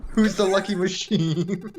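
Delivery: usual speaking voice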